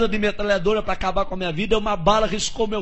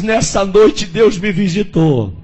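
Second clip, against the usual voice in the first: preaching; 7 dB louder than usual. Retold or imitated. Messenger delivering God's message.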